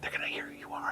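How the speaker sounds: urgent shushing, fully whispered; hushing whisper. Retold or imitated. Wife hushing husband talking smack in Target, hushing whisper. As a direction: whisper it so nobody around overhears. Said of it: "my wife often will say".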